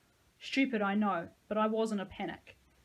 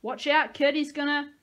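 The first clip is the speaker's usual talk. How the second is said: loud, higher, cut-off cry; desperate warning; 6 semitones higher, 9 dB louder than usual. A narrator's reading. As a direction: warn the kid urgently. Dying man's warning shout.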